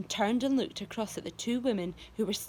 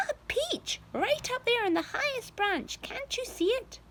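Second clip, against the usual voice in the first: high excited aunt. A narrator's reading. Spiker points out peach.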